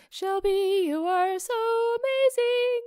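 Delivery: high, loud gushing admirer; gushing fan. Retold or imitated. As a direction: gush over her like an adoring fan. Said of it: "everyone will be like".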